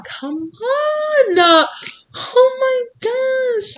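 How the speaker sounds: high, drawn-out squeal; squealed, long-held exclamation; excited exclamation. Real, not acted. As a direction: cry out high, stretching the vowels. Exclaiming excitedly over the cards.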